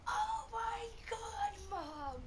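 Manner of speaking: high, loud, drawn-out mock wail; retold outraged cry. Retelling a girl's outburst at Mom, outraged cry.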